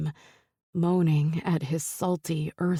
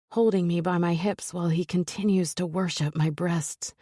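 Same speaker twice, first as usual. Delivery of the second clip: usual speaking voice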